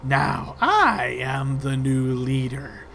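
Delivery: slow, drawn-out new leader voice; menacing rat woman; rat leader's declaration. Acted. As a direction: claim the throne slowly. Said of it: "she looks out towards you all and says".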